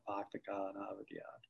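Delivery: quick, soft sample of a Boston accent. Shown as a demonstration. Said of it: "I can say what everybody can say".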